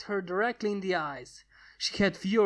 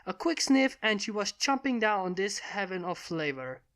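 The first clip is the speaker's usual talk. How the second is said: ordinary delivery, nothing marked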